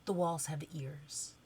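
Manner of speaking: soft, hushed warning; pretend whisper. Cinderella secretly warning her little friend, hushed. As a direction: drop it low in volume, like a secret. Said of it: "whispers really quickly and quietly".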